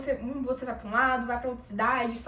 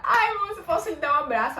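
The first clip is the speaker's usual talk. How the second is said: retelling a tearful, much higher and louder plea; 5 semitones higher, 7 dB louder than usual. Retold or imitated. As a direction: relive the emotional moment of asking for a hug, voice high and loud. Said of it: "eu comecei a chorar". (pedido choroso, bem mais agudo e alto)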